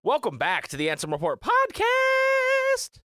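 high, loud, stretched-out host call; hammed-up announcer welcome; big show welcome. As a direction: belt it like a show opener.

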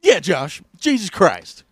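loud, high fed-up cry; mock exasperated shout. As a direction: throw up your hands at the suspect.

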